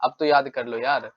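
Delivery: usual speaking voice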